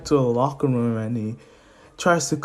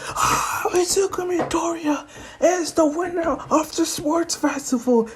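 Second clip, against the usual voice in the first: high, strained mock announcer; high hammed-up announcer cry; winner announcement. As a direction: proclaim the champion to an arena.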